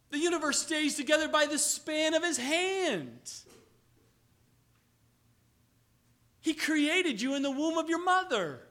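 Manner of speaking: loud, high, fervent preaching; preaching at full force. Acted. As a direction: lift it up and proclaim. Preaching crescendo on God.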